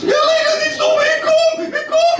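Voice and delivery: hammed-up shrill shriek of the Dutch girl; screaming Dutch girl. Comic acting out the Dutch girl in bed, high mock scream.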